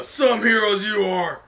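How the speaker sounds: mock the failed heroes; taunting sneer. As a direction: spit the contempt out slowly.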